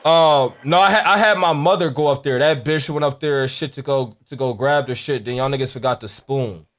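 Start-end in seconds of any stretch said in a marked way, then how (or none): none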